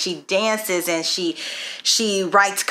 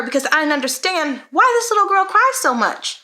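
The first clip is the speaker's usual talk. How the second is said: high-pitched exasperation, climbing at the end; worked-up retold frustration; frustrated; 10 semitones higher, 3 dB louder than usual. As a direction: relive your bafflement with a child, pitch soaring. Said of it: "I had been so frustrated with her".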